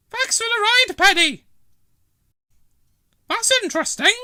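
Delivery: put-on very high, loud old lady snapping back; tart retort. Dillis retorting to Trevor from the bed push, tart. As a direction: put him in his place.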